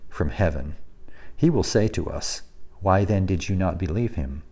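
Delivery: soft, measured scripture reading. Acted. Pastor reading the Gospel passage to the congregation, hushed. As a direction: read the passage quietly and steadily. Reads Matthew passage aloud.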